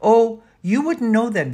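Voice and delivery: high, loud, hasty interjection; jumping in. A narrator's reading. Danny covers for Dell, jumping in. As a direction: butt in eagerly to cover up. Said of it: "Danny jumped in".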